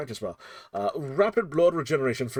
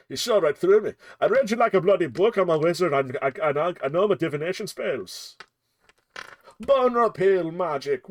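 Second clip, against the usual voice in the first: louder, higher, playful wizard boast; hammed wizard; 6 semitones higher, 6 dB louder than usual. Streamer plays a wizard boasting to a viewer, loud and playful. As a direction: ham up a smug wizard.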